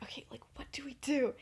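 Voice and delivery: quick, voiceless whisper. Retold or imitated. Lily asks James what to do, quick whisper. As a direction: hurry the question in a hush.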